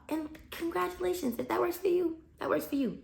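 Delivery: high, soft, sing-song politeness; mock congratulations. Real, not acted. Teacher dismissing strict colleagues' methods, with mock-sweet congratulations. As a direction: feign sweet approval, clearly not meant.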